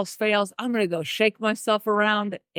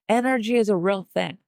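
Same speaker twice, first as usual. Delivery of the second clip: ordinary talk, nothing marked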